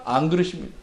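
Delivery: usual speaking voice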